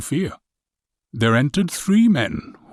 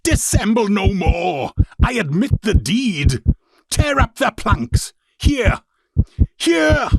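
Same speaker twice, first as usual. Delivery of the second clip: high, loud, frantic shouting; hysterical confession shout; 10 semitones higher, 4 dB louder than usual. Acted. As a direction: break down screaming the confession. I shrieked.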